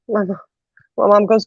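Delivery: ordinary delivery, nothing marked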